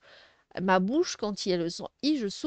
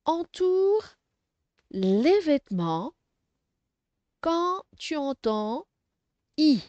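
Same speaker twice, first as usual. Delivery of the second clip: slow word groups with long pauses between; careful reading; 3 semitones higher, slower than usual. Shown as a demonstration. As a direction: read the instruction out bit by bit for beginners. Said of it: "Je lis bien la question".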